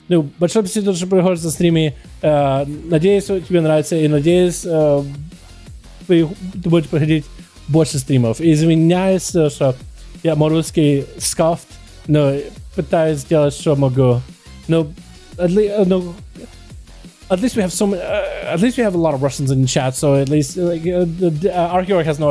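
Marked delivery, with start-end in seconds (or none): none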